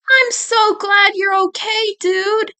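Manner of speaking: relieved and cooing over Eddie; Naz relieved. Acted.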